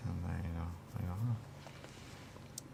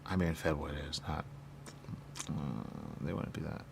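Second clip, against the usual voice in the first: ordinary talk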